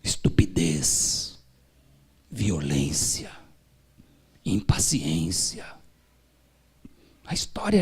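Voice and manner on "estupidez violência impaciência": slow, low and drawn out; slow solemn list. Acted. Preacher naming the sins, slow and low. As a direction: weigh each word slowly. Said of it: "slow listing".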